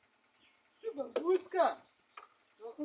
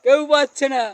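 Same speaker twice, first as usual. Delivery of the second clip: loud gloat; 3 semitones higher, 19 dB louder than usual. Real, not acted. Gloating that nobody can win.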